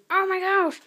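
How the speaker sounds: toy voice. Retold or imitated. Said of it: "he'd be going".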